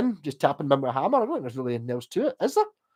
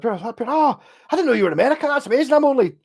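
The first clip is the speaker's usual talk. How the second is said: high, loud eager gushing; excited; 11 semitones higher, 5 dB louder than usual. Retold or imitated. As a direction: gush like a thrilled fan. Excited fan greeting in story.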